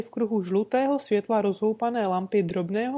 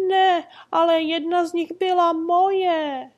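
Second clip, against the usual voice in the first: high, pleading little man's lament; wailing; 8 semitones higher, 6 dB louder than usual. Acted. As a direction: whine it up at the big trader. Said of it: "naříkal mužíček".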